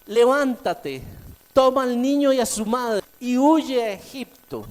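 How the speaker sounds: loud, high commanding call; voicing God's command. Preacher voicing the angel's command, loud and high. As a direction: ring out the angel's order.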